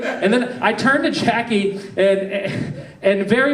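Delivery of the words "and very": normal talking voice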